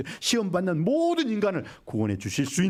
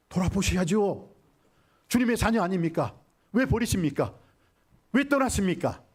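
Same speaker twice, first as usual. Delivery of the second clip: reproachful cry, pitched up and insistent; pleading protest; 7 semitones higher than usual. Acted. People crying to the Lord as if abandoned, pleading. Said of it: "우리는 그렇게 말을 합니다".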